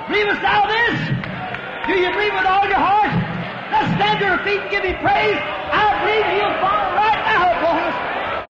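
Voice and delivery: high, shouted call to stand and praise; rally the congregation to their feet; fervent preaching. Real, not acted. Preacher urging the congregation to stand and praise, high fervent shout.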